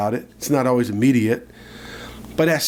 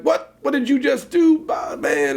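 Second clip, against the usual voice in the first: mom catching him in the act, yelling; imitating an angry mother's shout; mom's shocked yell; 12 semitones higher than usual. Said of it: "she was mad".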